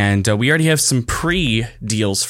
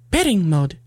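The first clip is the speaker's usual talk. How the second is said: higher-pitched imitation of a Chinese accent; 8 semitones higher than usual. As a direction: say it the way a cheap gadget's voice does, in a Chinese accent. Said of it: "like, a Chinese accent".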